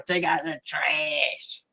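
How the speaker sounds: soft, slow, eerie mutter; weird quiet voice. Retold or imitated. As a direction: drag it out low and quiet like a ghostly heckler. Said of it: "you'll hear the voice go".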